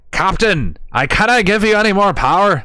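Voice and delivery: loud, high attempt at a Scottish accent. Streamer obliging a viewer's bits request, loud mock Scottish accent. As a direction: belt it out in a badly faked Scottish accent. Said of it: "in a Scottish accent".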